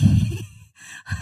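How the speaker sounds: squealing real laugh; sudden high laugh at the story; high burst of laughter. Real, not acted. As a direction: break into a high giggle. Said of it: "I just think it's so funny".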